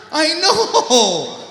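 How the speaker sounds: loud, high laughing outburst; mock outrage. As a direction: erupt in fake shock.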